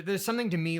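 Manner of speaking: ordinary talk, nothing marked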